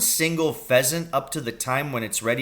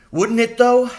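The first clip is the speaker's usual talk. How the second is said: excited shout, higher and louder; 11 semitones higher, 8 dB louder than usual. A narrator's reading. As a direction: burst out with glee. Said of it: "he shouted".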